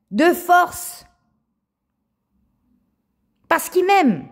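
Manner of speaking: high, loud fervent cry; fervent proclaim. Real, not acted. Telling viewers God loves her, high loud fervor. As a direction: lift it up high in loud praise.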